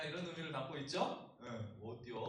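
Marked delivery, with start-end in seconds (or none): 1.8-2.2 Chungcheong dialect. Retold or imitated. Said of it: "충청도에서는 뭐라고 할까요?".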